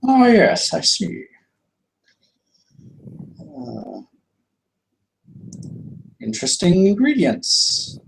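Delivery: put-on high, polite old shopkeeper; welcoming the party back to the shop, high and courteous. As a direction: greet the customer in a lifted, genteel voice. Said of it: "and be like".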